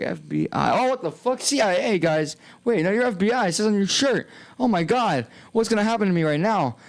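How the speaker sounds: high, alarmed retelling of own panic; panicked reaction. Retold or imitated. Streamer reenacting seeing the FBI, high and panicked. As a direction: replay the moment you saw the feds, freaking out. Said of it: "I was like".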